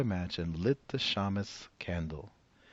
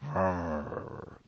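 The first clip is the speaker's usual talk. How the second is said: bear growl. Acted. Bear growling over the nuts. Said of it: "growled Old Bear".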